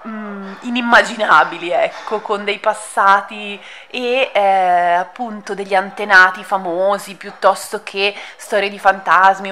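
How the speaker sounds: ordinary delivery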